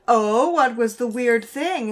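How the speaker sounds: drawn-out, loud "oh" of interest; acted-out curious question, stretched and loud; feigned curiosity. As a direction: ask it slowly, playing innocent and intrigued. Acts out own curious reply.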